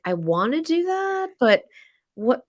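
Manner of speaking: high, quick doubtful guest voice; unsure, wary question; hesitant guest. Retold or imitated.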